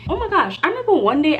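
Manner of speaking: high burst of remembering; sudden recall. Real, not acted. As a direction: exclaim as the memory hits. Remembering a scary drive.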